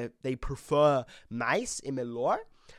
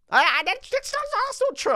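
mock outrage; 3 dB louder than usual. Real, not acted. Protesting he's not boring.

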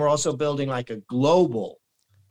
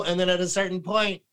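ordinary talk

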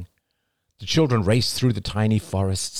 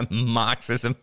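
high, scoffing Marxism?; high nasal voice; 6 semitones higher than usual. A narrator's reading. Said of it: "Jada laughed".

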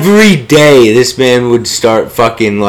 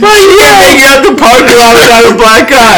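high, loud voice cracking with laughter; laughing mock counselor; 10 semitones higher, 3 dB louder than usual. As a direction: squeal it through laughter.

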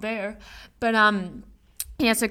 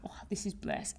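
whispered dreamy sigh of bliss; soft whispered bliss; blissful whisper; 10 dB quieter than usual. Retold or imitated. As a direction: breathe it out in a hush. Quoting her blissed-out thought.

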